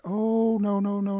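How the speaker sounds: high, drawn-out protest; storybook character balking at a request; reluctant refusal. Acted. Turtle refuses to lend whistle.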